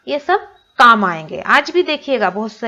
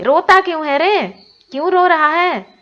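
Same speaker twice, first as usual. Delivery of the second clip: put-on higher, louder old granny asking why he cries; granny comforting; 8 semitones higher, 5 dB louder than usual. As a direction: comfort the grown son as granny. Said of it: "दादी माँ लेखक के पिताजी को बोल रही हैं".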